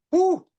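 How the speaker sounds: high, loud "woo"; short excited cheer; imitated whoop. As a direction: rock singer's falsetto whoop. Imitates [name]'s cheer on stage.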